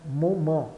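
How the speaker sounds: moment as "mo-man", last vowel nasal, T silent. Shown as a demonstration. Teacher sums up the word, moment ending in nasal AN. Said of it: "E o N soa an".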